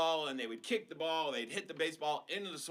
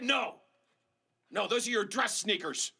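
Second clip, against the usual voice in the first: firm mom; 5 semitones higher, 4 dB louder than usual. Acted. Mom refusing.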